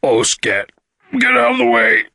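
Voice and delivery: loud alien bellow, leaping high; alarmed creature yell; panicked shout. A narrator's reading. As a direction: shout to clear the way urgently. Karg racing to the toilet.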